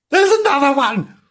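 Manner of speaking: high-pitched kitten alarm; hammed-up squeal of alarm; alarmed cry. A narrator's reading. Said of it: "cried Bernard".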